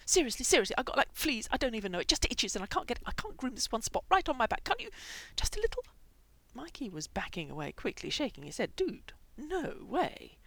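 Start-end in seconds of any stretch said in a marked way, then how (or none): 0.1-5.8 high, loud, breathless begging; frantic pleading. A narrator's reading. Itchy hamster begs for grooming. 8.8-10.4 high, drawn-out, slow refusal; firm refusal. A narrator's reading. Refuses backing away from the pleader.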